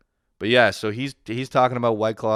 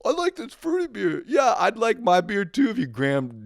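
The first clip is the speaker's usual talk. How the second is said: put-on high squeaky childish parody; squeaky mock voice; 13 semitones higher, 5 dB louder than usual. Host mimics his friend praising fruity beer, squeaky childish mock. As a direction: squeak it like a little kid.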